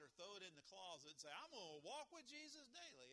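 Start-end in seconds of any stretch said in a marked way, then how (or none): 1.3-3.1 high-pitched imitation of a keen believer; mimicked claim. Preacher imitates someone who shelved the Bible, high-pitched mock pledge. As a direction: lift it right up, imitating someone's empty pledge.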